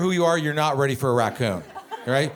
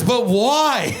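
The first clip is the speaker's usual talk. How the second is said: loud, high hammed-up wail; anguished disbelief; 5 semitones higher, 5 dB louder than usual. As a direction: plead like a bewildered brother. Brother's anguished question to dad.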